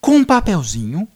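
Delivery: slow, loud, portentous narrator; mock-epic storytelling. Revealing two Dungeon Master figures arriving, slow mock-epic narration. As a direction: draw out each phrase like a fantasy reveal. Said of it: "Caverna do Dragão".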